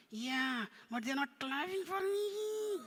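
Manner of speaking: high, drawn-out puppet whine; complaining puppet. Pretend. Puppet complains about missing applause.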